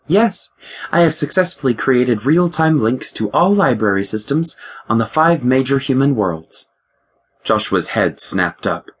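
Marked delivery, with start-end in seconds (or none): 0.1-6.7 higher, crisp voice of a ship's computer; lightly voiced ship AI, precise and higher. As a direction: play the ship's mind, a touch superior. The ship AI speaks.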